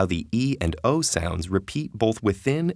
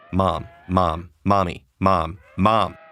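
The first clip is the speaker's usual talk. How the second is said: put-on an insistent child's call that grows louder; clamoring child; 2 semitones lower, 5 dB louder, slower than usual. A child demanding her mother's attention, growing louder. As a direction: keep calling mom, louder each time. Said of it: "a young child clamoring for her mother's attention".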